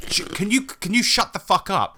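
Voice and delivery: high, exasperated demand; annoyed. Acted. Scolding loud eater.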